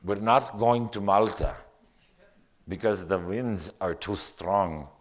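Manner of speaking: put-on ship captain's announcement in a Norwegian accent. Poet voicing the captain who cancels Malta, Norwegian accent. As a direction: attempt a Norwegian captain, firm and deliberate. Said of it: "my best Norwegian accent on this".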